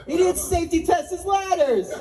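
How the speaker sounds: high, laughing through the line; laughing punchline. Real, not acted. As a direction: crack up while saying it.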